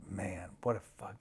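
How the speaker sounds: unvoiced whispered realization; hushed regret; whispered dismay. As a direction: breathe it out in dismay. Whispered moment of clarity.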